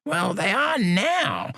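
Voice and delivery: high, slow cranky retort; high, slow cantankerous old voice; cantankerous old man. Acted. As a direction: snap back like a crotchety old man. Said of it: "he said cantankerously".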